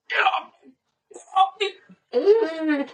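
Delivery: high, strained mock cry of pain; pained protest. Injured father on the ground refusing help, pained high protest. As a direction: imitate a hurt man fending off helpers, very high.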